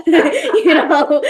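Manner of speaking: bursting into a high, loud laugh; laughing at her brother's slow mornings, high and loud; high laughter. Real, not acted. Laughing loudly while telling about her brother.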